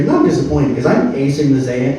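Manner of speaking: ordinary talk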